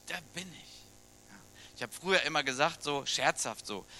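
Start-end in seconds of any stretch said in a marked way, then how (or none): 0.0-1.3 quiet. Real, not acted. Slow hushed emphasis.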